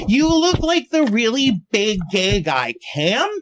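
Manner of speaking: put-on high, slow drunken declaration; drunk girl. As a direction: imitate a tipsy girl stretching each word. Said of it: "this really drunk girl".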